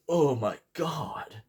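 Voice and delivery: drawn-out stunned exclamation, re-enacted; shocked reaction. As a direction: relive the horror of the gory scene. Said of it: "out loud was like".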